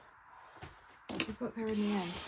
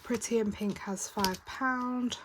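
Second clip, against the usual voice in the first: ordinary talk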